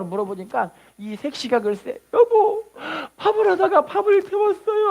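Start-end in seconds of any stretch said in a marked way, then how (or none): 2.1-4.9 high, crying bride's voice; tearful young bride. Retold or imitated. Young bride crying to her husband, high and tearful. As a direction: whimper it like a bride who burned the rice. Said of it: "하고 우는 거예요".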